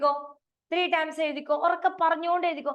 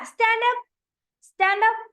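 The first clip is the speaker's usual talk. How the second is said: high, loud command; 8 semitones higher, 5 dB louder than usual. Real, not acted. Teacher commanding kids to stand.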